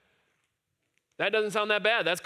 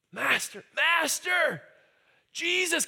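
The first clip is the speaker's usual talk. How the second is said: rising, higher cries of master; yelling for Jesus; 9 semitones higher, slower than usual. Retold or imitated. Lepers calling to Jesus from afar, rising shout. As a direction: call out to get his attention. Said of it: "They have to yell out".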